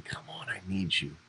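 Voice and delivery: Jesus calling us softly; tender call. Retold or imitated. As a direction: call gently, close and quiet.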